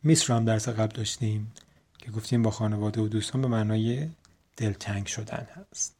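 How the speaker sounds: ordinary talk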